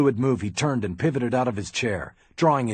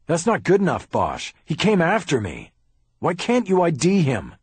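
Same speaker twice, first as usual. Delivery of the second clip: raised, much higher furious protest; angry demand; 9 semitones higher, 5 dB louder than usual. Acted. Haller snapping at Bosch, loud and much higher. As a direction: push back hard at the detective.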